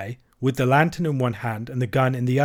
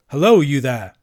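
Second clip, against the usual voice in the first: loud call through a door; hailing; 6 dB louder than usual. A narrator's reading. Gamekeeper challenging those behind the door, hailing. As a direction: hail whoever is inside.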